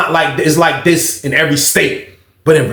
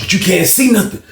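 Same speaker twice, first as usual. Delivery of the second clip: ordinary talk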